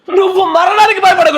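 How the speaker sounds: jubilant cry. Acted. God's word quoted, shouted.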